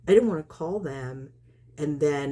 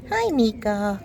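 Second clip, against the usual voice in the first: high, louder cat greeting; greeting a pet; 7 semitones higher, 5 dB louder, slower than usual. Real, not acted. Woman spots her cat Nika, high bright greeting. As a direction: lift the pitch to greet a cat.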